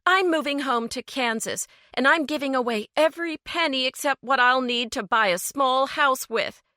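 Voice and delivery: rising anger; getting angrier; escalating anger. A narrator's reading. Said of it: "Her voice escalated with every word".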